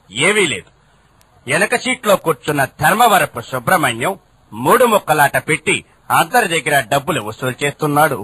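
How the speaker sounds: lightly formal low newsreader tone; a hint of the news voice. Reporter telling the boss about the card game. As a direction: report it like a bulletin.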